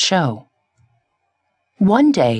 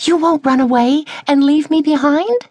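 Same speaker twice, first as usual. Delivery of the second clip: hopeful plea; 9 semitones higher than usual. A narrator's reading.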